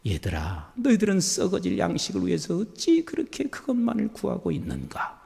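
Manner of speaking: low, soft voice of a gentle Jesus; Jesus speaking. Retold or imitated. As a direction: speak down softly like a loving teacher. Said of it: "예수님께서 뭐라고 말씀하시는 줄 아세요".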